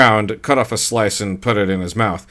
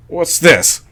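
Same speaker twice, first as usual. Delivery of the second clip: demanding; 7 semitones higher than usual. A narrator's reading. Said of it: "Gary demanded".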